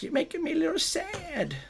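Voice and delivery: high, very soft pitiful whimper; mock sad. Streamer tells Ness she hurt him, pitiful. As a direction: shrink into a tiny wounded voice.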